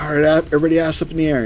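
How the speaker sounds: high, fast barked command; lightly voiced sergeant command; drill sergeant order. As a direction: call it out to the platoon. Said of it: "they'd be like".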